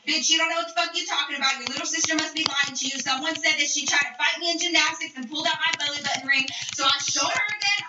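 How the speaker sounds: rapid-fire angry retelling; really fast rant. Retold or imitated. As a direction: rattle it off without stopping for breath. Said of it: "started talking really fucking fast".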